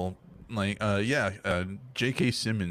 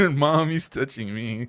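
put-on slow whiny kid complaining, louder; whiny tattling little sibling; 4 semitones higher, 6 dB louder, slower than usual. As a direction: whine it like a tattling little brother. Joking about the camera framing.

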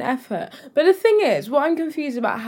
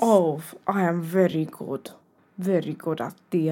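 put-on Halloween accent; 4 semitones lower, 5 dB quieter than usual. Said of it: "I'm really bad at accents".

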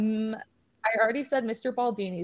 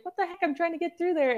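pitched-up frustrated outburst; exasperated; 7 semitones higher, faster than usual. Retold or imitated. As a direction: sound fed up at being blocked, voice jumping high. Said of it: "I'd be like".

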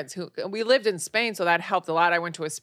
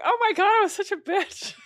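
high-pitched self-reproach; mock horrified realization. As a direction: exclaim it, appalled at your younger self. Acted realization of her past meanness.

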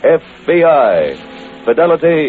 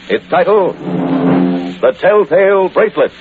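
dramatic announcer; 6 semitones lower than usual. Acted. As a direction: draw out the title for effect. Announces next title.

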